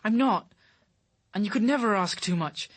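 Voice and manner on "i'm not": louder, higher insistence; emphatic protest. Acted. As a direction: insist hard, hurt. Protests to Caroline.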